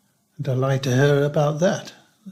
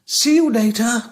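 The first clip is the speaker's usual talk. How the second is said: high, louder mock farewell; cheery carer goodbye; 9 semitones higher, 6 dB louder than usual. Resident mimicking carers' parting words. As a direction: parrot the staff's bright goodbye. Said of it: "But they'd say".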